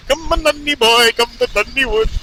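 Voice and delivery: high, loud, badly faked Irish accent. Rider retrying after sounding Indian, bad Irish accent. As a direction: push a poor Irish lilt up high. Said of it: "that's not good".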